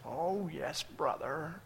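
hushed, soft pious churchgoer; mock religious piety. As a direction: send up a meek, religious amen. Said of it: "just be religious".

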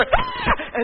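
very high startled cry; mock scared shriek. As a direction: shriek as if dreading the photo. Person gasps at the photo.

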